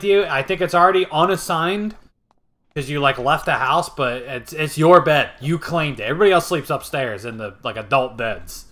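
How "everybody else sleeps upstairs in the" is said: ordinary talk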